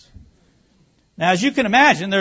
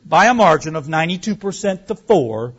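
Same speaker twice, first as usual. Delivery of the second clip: ordinary talk, nothing marked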